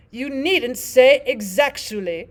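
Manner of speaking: slow, loud put-on Queen voice; correcting Queen. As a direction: correct her grandly, slowly.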